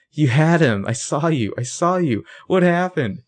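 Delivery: high, agitated imitation of an accusation; mock reproach. Spencer confronting spaced-out Toby, mock reproach.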